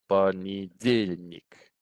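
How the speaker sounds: понедельник drawn out slowly as по-не-ДЕЛЬ-ник; slow, measured понедельник in four beats; four separate syllables. Shown as a demonstration. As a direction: spell out each syllable of понедельник, stressing the third. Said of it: "there's four syllables in that word".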